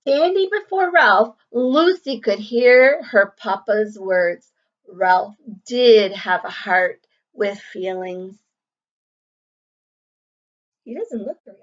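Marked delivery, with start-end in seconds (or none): 0.1-8.4 clear, lively storybook reading to a class; reading to children. A narrator's reading. Teacher reading a picture book to the class, lively and clear. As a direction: read the story aloud to young pupils.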